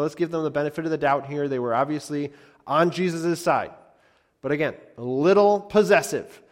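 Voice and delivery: ordinary delivery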